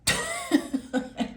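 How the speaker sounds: quick high amused laugh; short laugh. Real, not acted. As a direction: let a brief laugh escape. Laughs at messed-up person.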